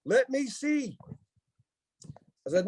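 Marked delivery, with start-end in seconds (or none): 0.0-1.0 high-pitched retold request; mock-eager aunt, pitched way up; imitating his aunt. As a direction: put on a high, insistent aunt voice. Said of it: "she goes".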